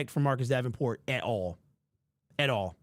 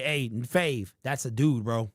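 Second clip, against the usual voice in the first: usual speaking voice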